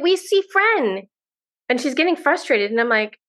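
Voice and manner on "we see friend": put-on high, slow, simple child's phrasing; little daughter's voice. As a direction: imitate a small girl insisting, slowly and high. Daughter insisting in her words.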